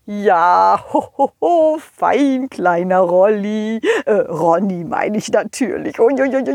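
ordinary delivery

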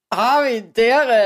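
slow, drawn-out unimpressed groan; groaning reaction. Retold or imitated. Listener groaning slowly at a stale joke. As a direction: drag it out like a bored listener. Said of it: "sich denken".

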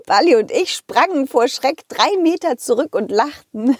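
plain reading voice

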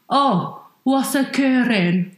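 slow, hammed-up Welsh catchphrase; Nessa. Quoting a famous British programme's character, strongest Welsh accent. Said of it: "she has the strongest Welsh accent".

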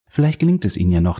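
ordinary delivery, nothing marked

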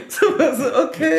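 laughing through the words, high; cracking up mid-story. Real, not acted. Friend waves ciao, he laughs the words out.

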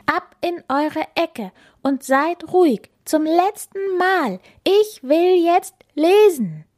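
put-on high, firm girl's voice, building; exasperated order. Bella sending friends to their corner, exasperated.

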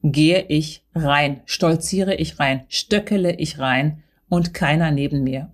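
ordinary talk, nothing marked

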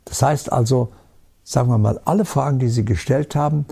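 usual speaking voice

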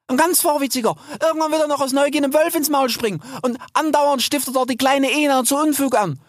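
high, rapid, worried chatter; apologetic young sow. A narrator's reading. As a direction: babble anxiously about troublesome siblings. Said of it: "sagte sie entschuldigend". (entschuldigend, hoch plappernd)

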